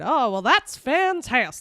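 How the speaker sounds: put-on slow, bright old-timey delivery; fifties voice. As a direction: say it like a fifties sitcom dad. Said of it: "such a fifties word".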